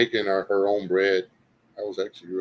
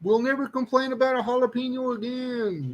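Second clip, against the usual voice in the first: very high, squeaky little creature voice; hollering taste buds; 13 semitones higher than usual. Acted. Taste buds swearing off complaints about jalapenos, comic high-pitched hollering. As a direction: plead in a tiny, high cartoon voice. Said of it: "little taste buds screaming and hollering".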